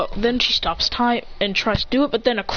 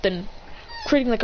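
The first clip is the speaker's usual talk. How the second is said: ordinary delivery, nothing marked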